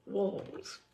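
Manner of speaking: usual speaking voice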